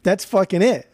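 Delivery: loud and emphatic; insistent. Real, not acted. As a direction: hammer the point home. Insisting in an argument.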